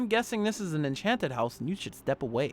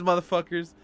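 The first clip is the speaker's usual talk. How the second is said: ordinary talk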